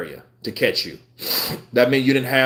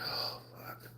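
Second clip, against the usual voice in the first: whispered oh no to himself; whispered panic; 21 dB quieter than usual. Retold or imitated. Realizing he will be fired, whispered panic. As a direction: breathe it out, voiceless. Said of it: "I'm like".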